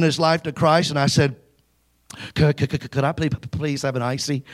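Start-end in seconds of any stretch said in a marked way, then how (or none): 2.4-4.4 put-on stammering softly, scared teenager; mild nervous stutter, a touch quieter. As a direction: stutter the request out timidly. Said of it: "I was shaking".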